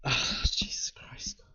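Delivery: breathy, slow disbelieving whisper; exasperated whisper. Real, not acted. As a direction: whisper it under your breath, fed up. Exasperated at friend's film opinion.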